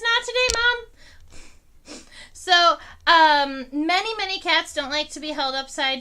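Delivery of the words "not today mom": high, light voice for the cat's refusal; voicing the cat. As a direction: speak as a sassy little kitten, high. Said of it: "oh, she says".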